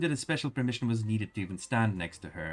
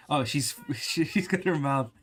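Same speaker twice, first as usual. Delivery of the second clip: higher amused giggly comment; laughing; 5 semitones higher, 4 dB louder than usual. Real, not acted. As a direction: laugh while pointing it out. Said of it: "she's talking with her mouth full".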